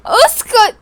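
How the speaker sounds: high, loud crying shout; tearful snap. Pretend. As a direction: cut him off through tears. Angry girl silences him.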